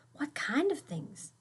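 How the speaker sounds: whispered, plotting; scheming whisper. Acted. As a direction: whisper like hatching a plan. Child plotting in secret.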